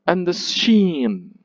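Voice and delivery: quick light "And the", long high SHEEN; two weak syllables, then land on "sheen"; anapest, stress on SHEEN. Shown as a demonstration. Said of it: "and then stressed".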